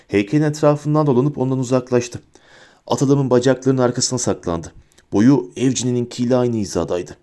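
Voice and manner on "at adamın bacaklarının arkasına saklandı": ordinary delivery, nothing marked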